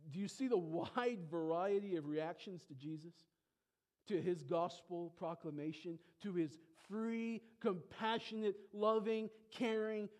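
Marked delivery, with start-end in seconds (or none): none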